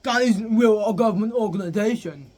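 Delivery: hammed-up obnoxious outburst; annoying sister. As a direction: imitate an irritating sister laying down the law. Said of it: "She is the most annoying thing ever".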